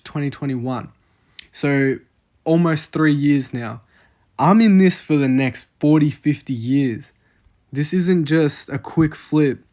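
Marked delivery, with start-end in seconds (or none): none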